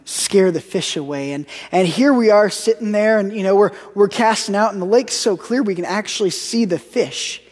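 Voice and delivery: ordinary talk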